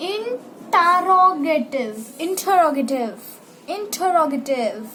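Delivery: interrogative with a heavy stressed syllable; stressed demo word. Shown as a demonstration. Teacher drilling interrogative with strong stress. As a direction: lean hard on the stress in interrogative. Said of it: "इस वाले word पे आपको pressure देना ज्यादा".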